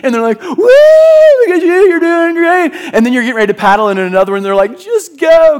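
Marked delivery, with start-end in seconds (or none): 0.4-2.7 high, loud, drawn-out cheer; cheering whoop. Retold or imitated. Pastor imitating surfers cheering someone on, high and loud. 4.8-5.6 higher, louder friendly urging; encouraging shout. Retold or imitated. Pastor voicing a happy local urging him into a wave.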